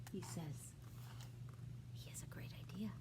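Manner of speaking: whispered, breathy excitement; hushed. A narrator's reading. Whispering to the child about Bear's great idea. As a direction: lean in and whisper the secret.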